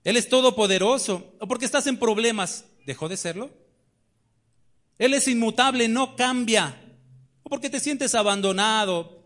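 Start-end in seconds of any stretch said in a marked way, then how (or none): 0.0-1.4 raised, ringing pulpit voice; fiery preaching. Acted. Preacher challenging the congregation, raised and ringing. 5.0-6.8 raised, ringing pulpit voice; fiery preaching. Acted. Preacher challenging the congregation, raised and ringing.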